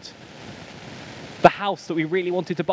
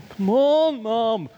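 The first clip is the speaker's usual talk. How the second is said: higher, louder drawn-out whine to mum; retold kid impatiently pleading; exasperated child's plea; 6 semitones higher, 4 dB louder, much slower than usual. Kids dragging Mum home from church, exasperated pleading.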